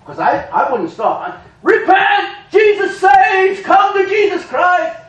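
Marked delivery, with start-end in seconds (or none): 1.6-5.0 street preaching shout. Retold or imitated.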